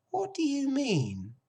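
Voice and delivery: high-pitched puzzled piglet; innocent young pig. A narrator's reading. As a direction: ask as the puzzled little pig.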